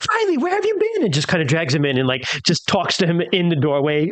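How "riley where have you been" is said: much higher, cheerful fake surprise to waylay him; mock-surprised call. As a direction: grab his attention with a bright, much higher greeting. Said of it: "Rose is like".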